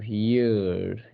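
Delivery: "ईयर" stretched long and slow; drawn-out yu sound. Shown as a demonstration. Teacher stretching the sound so students hear it. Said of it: "यू".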